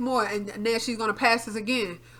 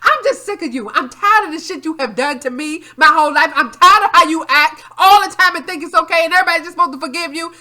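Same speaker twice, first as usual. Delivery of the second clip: retold angry outburst; 5 semitones higher, 10 dB louder than usual. Retold blowup at mother.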